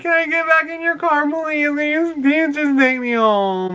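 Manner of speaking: high, whiny begging imitation; mock tearful pleading. Drunk girl begs driver for a lift, mock crying. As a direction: beg in a wail, dragging out the end. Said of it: "She's on the phone crying".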